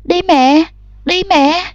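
high, desperate begging. A narrator's reading. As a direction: beg harder and harder. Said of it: "càng lúc càng khẩn thiết". (năn nỉ càng lúc càng khẩn thiết)

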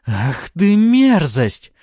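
high, loud, drawn-out cry of disgust; enraged. A narrator's reading. Succubus realising she said too much, enraged. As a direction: stretch out the insult in rage.

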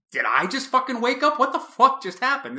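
high, frantic disbelief; high-pitched, exasperated questioning; bewildered outburst. Retold or imitated. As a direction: re-enact losing your grip on reality. Acted bewildered outburst after the shock.

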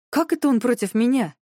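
outraged disbelief; indignant. Acted. Indignant outburst.

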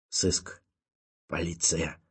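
whispered on spent breath; breathless gasp. A narrator's reading. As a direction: gasp the words out, no air left. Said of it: "кое-как выдохнул Ванзаров".

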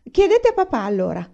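high, loud, piqued comeback; offended, throwing it back at them; piqued retort. A narrator's reading. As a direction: retort sharply, stung, starting high and loud. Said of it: "ribatté Laura piccata".

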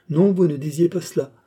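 high-pitched scornful contradiction; haughty correction, voiced high; disdainful. A narrator's reading. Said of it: "murmura-t-elle avec dédain".

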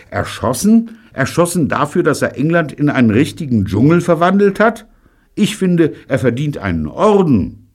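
hoch und laut, voller Begeisterung; begeistert empört. A narrator's reading. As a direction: feuere den Protest mit Schwung ab. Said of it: "noch ganz begeistert von Davids Bericht". (Michael verteidigt David laut und begeistert)

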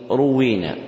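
روينا with damma on ra, doubled waw; u vowel, then "wwee" in روينا; ruwwina pronunciation. Shown as a demonstration. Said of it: "بضم الراء وكسر الواو مشددة".

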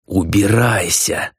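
slow, drawn-out snarl; angry command, stretched out; growled order. A narrator's reading. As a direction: growl it slowly as a dismissal. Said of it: "прорычала она".

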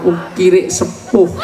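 slow, high, refined Javanese put-down; mock polite insult. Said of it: "Nggak mungkin orang marah kok". (makian halus ala Jawa, pelan dan tinggi)

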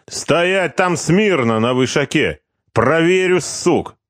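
high, slow barked order; bossy order. Acted. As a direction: threaten to check them. Corporal barks at sentries.

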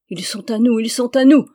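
Bruno's elated battle yell; joyful yell. Acted. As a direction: roar it out, drunk with victory. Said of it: "hurlait Bruno, ivre de joie".

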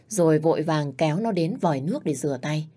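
ordinary delivery

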